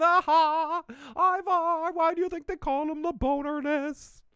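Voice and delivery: high mocking sing-song taunt. Margrethe mocking Ivar at dinner, high taunt. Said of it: "laughs and calls him".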